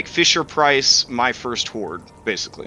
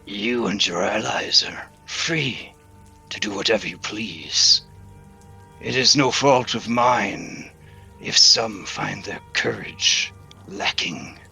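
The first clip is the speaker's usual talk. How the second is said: a hint of a smug old dragon rumbling; deep gravelly dragon voice; 2 semitones lower than usual. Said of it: "In a deep, gr-, gravelly, uh, voice".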